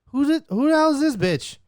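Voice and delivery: high, loud disbelieving outcry; shocked outburst. Retold or imitated. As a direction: blurt it out in shock. Retold reaction, high and loud.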